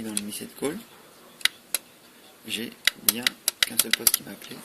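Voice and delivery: ordinary delivery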